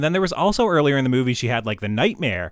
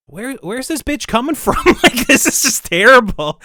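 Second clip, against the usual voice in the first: higher-pitched mock disbelief; retelling her shock, pitched up; incredulous reaction; 11 semitones higher, 7 dB louder than usual. Viewer retelling her reaction to the nurse, mock incredulous.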